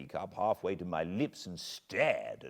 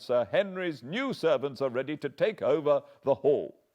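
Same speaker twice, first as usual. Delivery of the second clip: much higher and louder alarmed butler; frightened servant warning about the moor; fearful outburst; 11 semitones higher, 10 dB louder than usual. Acted. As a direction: pour out his dread of the moor.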